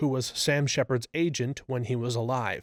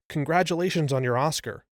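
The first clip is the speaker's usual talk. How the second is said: ordinary talk, nothing marked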